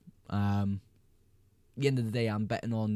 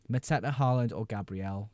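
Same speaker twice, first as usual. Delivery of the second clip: usual speaking voice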